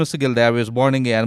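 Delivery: ordinary talk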